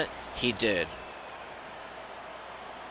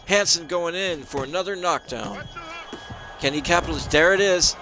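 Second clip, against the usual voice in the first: excited commentary; 7 semitones higher, 4 dB louder than usual. Real, not acted. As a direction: pitch up, urgent knockdown call. Knockdown call, live commentary.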